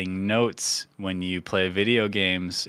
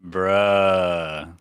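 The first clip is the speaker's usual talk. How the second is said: exasperated bruh; 4 semitones lower than usual. Real, not acted. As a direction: blurt out bruh in disbelief. Reaction to long notes.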